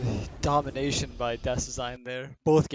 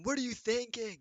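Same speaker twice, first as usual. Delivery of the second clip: high-pitched mock scolding; mock flaming; 10 semitones higher, 3 dB quieter than usual. Co-caster pretends to flame a player after the match, mock scolding. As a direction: jokingly berate a player in a raised pitch. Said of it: "so we can flame him".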